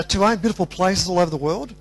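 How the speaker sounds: ordinary talk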